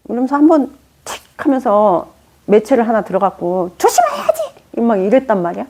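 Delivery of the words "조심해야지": much higher mock scolding; retold scolding. Mother scolding her child in anger, imitated high shout. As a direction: replay the sharp rebuke, hammed up. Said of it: "화낼 일이 아니었던 거야".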